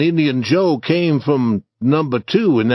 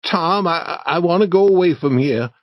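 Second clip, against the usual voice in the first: shaking voice; 6 semitones higher than usual. A narrator's reading. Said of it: "Huck said in a shaking voice".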